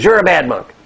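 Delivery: hammed-up furious accusation; angry monk. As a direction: play a colleague angrily waking someone. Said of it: "his colleague is angry".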